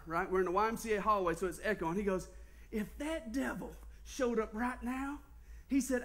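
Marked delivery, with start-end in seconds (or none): none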